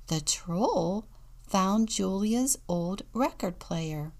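usual speaking voice

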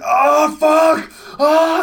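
loud, high hammed-up male moaning; mock orgasm moans. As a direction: ham up a man's sex noises. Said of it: "these guys going".

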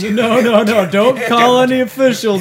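loud, high, hasty refusal; mock alarm. Interviewer hiding kidnapped people in walls, mock alarm. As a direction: protest loudly in comic panic.

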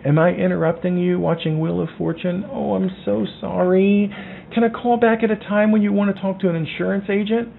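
grovel like a nervous salesman afraid to intrude; overly sorry agent tiptoeing on the phone; timid apologetic agent. Retold or imitated. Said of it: "now is not the time to be like".

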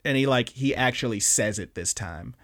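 normal talking voice

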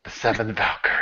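low, soft, cool name drop; real cool. Shown as a demonstration. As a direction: drop your voice to sound cool. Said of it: "That's how you're supposed to say it".

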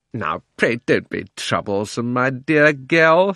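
lightly voiced cajoling uncle, drawn out at the end; wheedling uncle. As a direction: coax her, stretching the endearment slowly. Uncle wheedles aunt for money.

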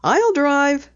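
put-on light girl voice for Penny; Penny, lightly voiced. A narrator's reading. Penny telling her dad she'll drive, lightly voiced girl.

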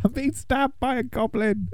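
high, softly squealed mock terror; hammed-up distress in a small, high voice; mock panicked reading. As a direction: read it as a tiny strangled cry for help. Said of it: "Gandalf's reading out the book".

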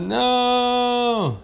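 high, long-held wail; falling cry. Acted. Rider falling off the whale, high drawn-out cry. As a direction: stretch it out as you slip away.

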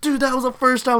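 high-pitched mock protest; indignant dispute. Teachers argue over a first down, mock-indignant. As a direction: imitate someone arguing the call.

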